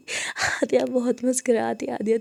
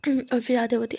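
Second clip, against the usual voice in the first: ordinary delivery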